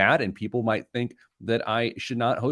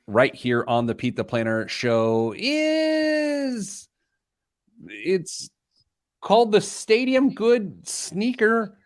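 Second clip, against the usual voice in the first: big drawn-out announcer reveal; 4 semitones higher, slower than usual. Acted. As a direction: hype the reveal like a showman. Announces segment on radio.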